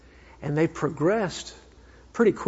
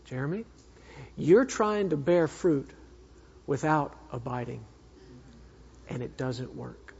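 gentle; 4 semitones lower, 4 dB quieter than usual. Retold or imitated. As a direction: let the words settle gently, like a patient father. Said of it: "He was so gentle with me".

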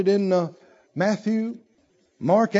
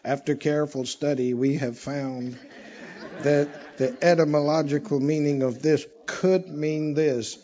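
dry scholarly scribe; 5 semitones lower than usual. Acted. Preacher mimics how the scribes teach, dry and droning. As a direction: drone it out like a cautious academic. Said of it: "how are the scribes teaching?".

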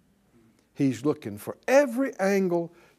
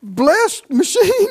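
high, loud outburst stuck on "you", then a drawn-out save; hammed-up fury turned into a forced blessing; mock anger, self-corrected; 11 semitones higher, 8 dB louder, much slower than usual. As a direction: start to curse, catch yourself and bless instead. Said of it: "catch yourself mid-sentence".

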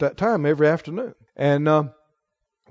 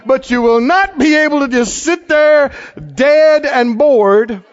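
high, loud, emphatic exhortation; full-force preaching; 7 semitones higher, 4 dB louder than usual. Real, not acted. Preacher urging congregation to show it, forceful preaching. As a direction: drive it home to the congregation, voice raised.

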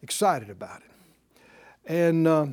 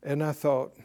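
ordinary talk, nothing marked

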